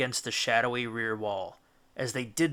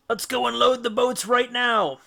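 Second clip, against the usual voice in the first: eager; 9 semitones higher, 5 dB louder than usual. Acted. Eager crewman urging.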